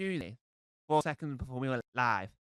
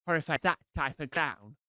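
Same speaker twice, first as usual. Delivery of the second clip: normal talking voice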